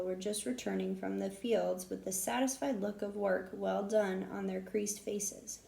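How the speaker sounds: ordinary delivery, nothing marked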